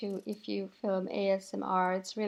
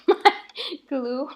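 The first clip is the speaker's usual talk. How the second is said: laughing; 4 semitones higher, 7 dB louder than usual. Real, not acted. Crafter amused by the wavy movement of the glue, laughing. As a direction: giggle breaking into the words.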